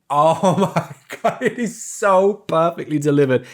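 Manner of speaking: usual speaking voice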